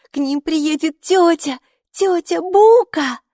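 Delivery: put-on high, loud, slow delighted child; thrilled little girl. A narrator's reading. Mikey rejoices the Buka is coming, high loud child voice. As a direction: savour the name with glee.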